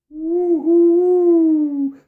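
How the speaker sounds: high, loud hooting owl cry; screech owl call. A narrator's reading. Narrator voicing a screech owl's sad trilling call. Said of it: "The sad trilling call of the screech owl".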